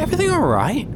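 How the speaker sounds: high, bright-pitched shopkeeper calling out; cheery high landlady voice calling across the street; Jeannie calling out. Acted.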